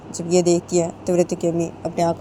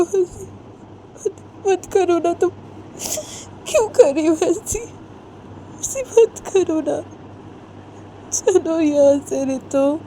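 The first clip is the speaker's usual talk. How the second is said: high, slow tearful plea; crying mother; 11 semitones higher, much slower than usual. Acted. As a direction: sob out the plea to her daughter. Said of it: "बहुत ही रोने लगती है". (रोती हुई माँ, ऊँची धीमी आवाज़ में विनती)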